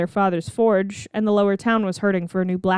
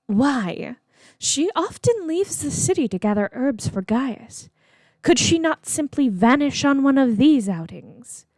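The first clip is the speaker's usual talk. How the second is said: higher-pitched, questioning schemer; lightly voiced impatient plotter; 6 semitones higher than usual. A narrator's reading. Morgana challenging her sister about the outings, impatient and higher. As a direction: press the point with a lifted voice.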